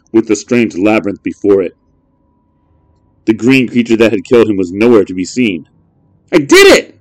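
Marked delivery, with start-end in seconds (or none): 6.3-7.0 put-on high, loud joyful kid; triumphant shout. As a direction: shout with victory. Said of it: "shouted Adam".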